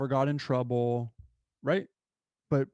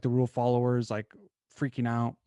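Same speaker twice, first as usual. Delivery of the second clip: usual speaking voice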